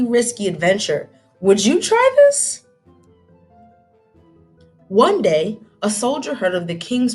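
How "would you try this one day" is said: ordinary delivery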